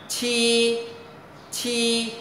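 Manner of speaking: "chi" with a puff of air, held high and level; aspirated sound. Shown as a demonstration. Teacher introduces the hard-to-read letter, aspirated sound. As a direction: push air through the teeth on "chi". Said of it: "âm hơi gió bật hơi".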